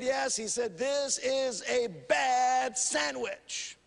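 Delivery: slow, soft, sulky complaint; grumpy passenger. Retold or imitated. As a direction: drag out the grievance like a spoiled customer. Passenger complains about sandwich.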